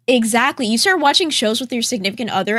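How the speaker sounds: ordinary talk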